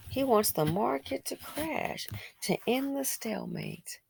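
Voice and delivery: soft, drawn-out claim fading to whisper; hushed. Real, not acted. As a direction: let it out slowly in a hush. Hushed repeat of his claim.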